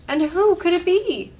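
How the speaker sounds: high, loud little-bear question; wee little Baby Bear voice. A narrator's reading. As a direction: ask excitedly as a small storybook bear. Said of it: "in his wee little voice".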